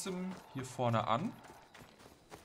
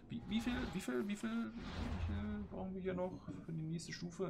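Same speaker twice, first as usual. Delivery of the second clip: high-pitched whiny question; 9 semitones higher, 5 dB quieter than usual. Real, not acted. Stuttering high questioning at level screen.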